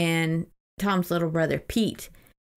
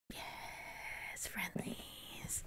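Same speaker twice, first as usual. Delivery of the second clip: whisper; 23 dB quieter, much slower than usual. Real, not acted. Excited whispered aside about ice cream.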